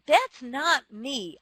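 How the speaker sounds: ordinary talk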